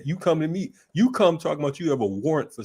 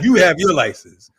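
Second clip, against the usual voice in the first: mock cop demand; 4 semitones higher, 9 dB louder than usual. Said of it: "You're like".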